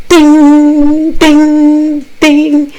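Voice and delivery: three high ringing dings; imitated ding. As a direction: chime it on one sustained high note. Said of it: "We went back in the room again".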